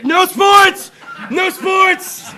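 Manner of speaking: loud high-pitched chant; shouted hook. Retold or imitated. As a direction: belt out a rap hook. Shouting a line from song.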